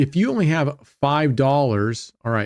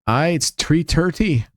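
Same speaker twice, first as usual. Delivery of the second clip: slow, practised Irish brogue; Irish accent; 3 semitones higher than usual. Pretend. Answering Brent's question in a practised Irish accent. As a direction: try out an Irish lilt, taking your time. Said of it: "I've been working on my Irish accent".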